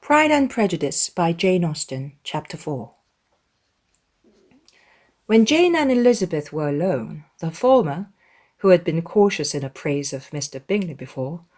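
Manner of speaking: usual speaking voice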